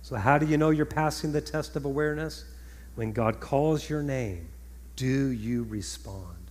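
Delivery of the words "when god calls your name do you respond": slow, low, softened question; weighty, drawn-out low delivery; measured question. Real, not acted. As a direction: ask it slowly and quietly, voice sinking. First asking of repeated question.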